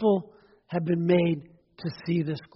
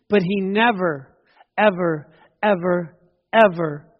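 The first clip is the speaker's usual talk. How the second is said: each "ever" struck on its own, louder toward the last; insistent never ever; 4 dB louder than usual. Acted. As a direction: pound every "ever" like a drumbeat.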